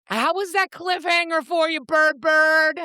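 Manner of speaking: very high teasing lilt; gleeful teasing. Real, not acted. Host asking a friend about the cliffhanger, high gleeful tease. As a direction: needle a friend in a high, playful voice.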